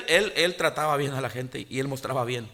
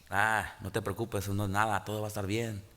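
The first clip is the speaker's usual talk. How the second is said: calm reassurance; 4 semitones lower, 6 dB quieter than usual. Retold or imitated. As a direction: soothe the angry man gently. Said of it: "Con toda calma".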